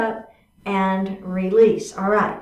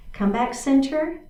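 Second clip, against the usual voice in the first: ordinary talk, nothing marked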